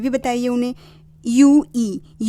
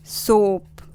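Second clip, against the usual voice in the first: normal talking voice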